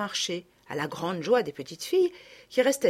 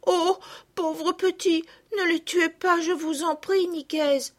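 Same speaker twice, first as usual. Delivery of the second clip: pleading; 9 semitones higher, slower than usual. A narrator's reading. Girl begging to spare them.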